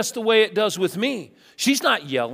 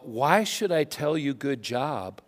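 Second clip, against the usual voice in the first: grudging perfectionist; 6 semitones lower, 6 dB quieter than usual. Retold or imitated. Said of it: "you look at all of us and go".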